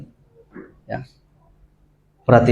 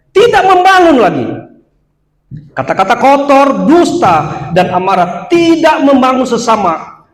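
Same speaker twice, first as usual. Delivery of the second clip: preaching shout; 7 semitones higher, 3 dB louder, slower than usual. Real, not acted. Preacher exhorting congregation.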